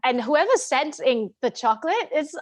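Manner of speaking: normal talking voice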